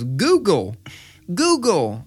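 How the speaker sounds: lightly imitated toddler talking to a watch. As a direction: lean in close and call like a small child. Toddler speaking into Apple Watch.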